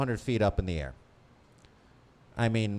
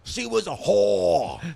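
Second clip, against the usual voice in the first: high, drawn-out mock yell; mock-shouted imitation; 10 semitones higher, 5 dB louder, slower than usual. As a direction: stretch it out loud and high, imitating.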